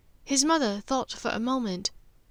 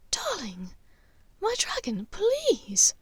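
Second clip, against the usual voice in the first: higher and higher, coaxing; wheedling; 11 semitones higher, slower than usual. Acted. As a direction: coax your son, rising to a plea. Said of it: "Narcissa wheedled".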